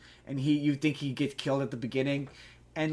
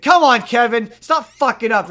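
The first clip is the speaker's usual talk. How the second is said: much higher, much louder mock yell at Kevin; exasperated yell; 8 semitones higher, 12 dB louder than usual. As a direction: blast the scolding at the hapless sidekick. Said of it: "he's like, he's like".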